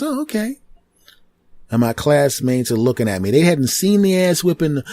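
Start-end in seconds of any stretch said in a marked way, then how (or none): 0.0-0.6 surprised teacher. Retold or imitated. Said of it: "She goes".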